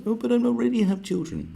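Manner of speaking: high nasal mock excuse; high mocking whine. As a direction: mimic an entitled excuse. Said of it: "people who've got money".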